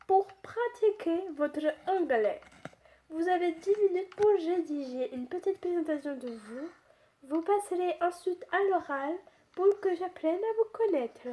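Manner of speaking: badly faked English accent, nearer German; attempted English accent. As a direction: play a teacher with a clumsy English accent. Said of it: "j'arrive pas à faire l'accent anglais". (accent anglais raté de professeure)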